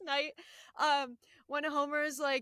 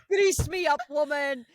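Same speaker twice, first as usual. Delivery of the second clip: Scottish quote; 2 semitones higher, 9 dB louder than usual. Retold or imitated. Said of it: "He's like".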